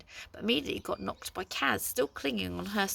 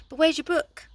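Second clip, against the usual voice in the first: wrong voice; 8 semitones higher, 10 dB louder than usual. A narrator's reading. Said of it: "No, sorry, that's the wrong one".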